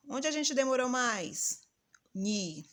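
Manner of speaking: normal talking voice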